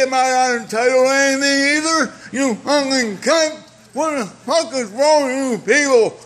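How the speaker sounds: usual speaking voice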